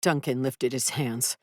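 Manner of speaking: ordinary delivery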